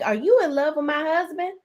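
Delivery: mimicking a wife confronting; [name] quoting. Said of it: "[name] is like".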